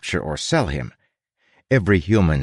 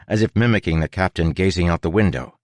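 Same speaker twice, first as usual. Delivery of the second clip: ordinary delivery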